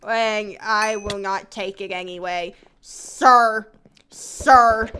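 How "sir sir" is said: loud scolding. Real, not acted. Scolding the egg thrower.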